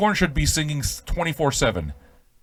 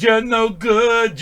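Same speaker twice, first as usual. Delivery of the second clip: slow drawn-out taunt; 8 semitones higher, 3 dB louder, much slower than usual. Pretend. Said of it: "You gotta tell him".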